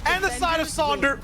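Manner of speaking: esports caster. Acted. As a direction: shout the winning play. Climactic call of final round.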